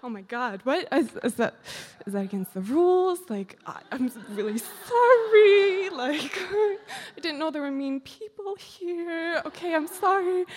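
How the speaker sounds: high, half-laughing, half-crying panic; a hammed-up scared kid apologizing, voice cracking; mock panicked apology. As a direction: plead sorry in a flustered, tearful rush. Panicked apology to stern woman.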